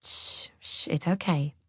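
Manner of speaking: soft, hushed reassurance; low, tender shushing; soothing. A narrator's reading. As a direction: hush someone gently. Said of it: "he soothes".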